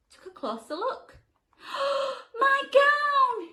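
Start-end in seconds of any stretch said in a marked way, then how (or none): 1.6-2.3 surprised gasp. Acted. George spots his old gown. 2.4-3.5 high, much louder happy yell; joyful yell. Acted. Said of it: "he yelled".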